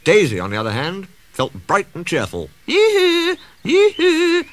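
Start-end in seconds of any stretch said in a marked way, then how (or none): 2.7-4.4 very high, loud hooting call; tooting yoo-hoo. A narrator's reading. Daisy leaves the yard, tooting cheerfully. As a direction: toot it out like a cheery horn. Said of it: "she tooted".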